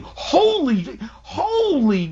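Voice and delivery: high mock yell, each word falling; half-hearted imitation of yelling in shock; retold yelling. Dad retelling how he yelled at the show. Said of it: "I know I was yelling".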